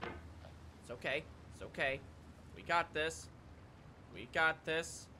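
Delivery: low, soft, steadying murmur; calm yourself down under your breath; quiet self-reassurance. Real, not acted. Reassuring self while playing.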